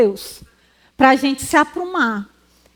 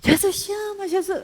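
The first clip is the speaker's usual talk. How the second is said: mock shout held back; 6 semitones higher, 6 dB quieter than usual. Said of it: "eu vou gritar, mas ele gritou".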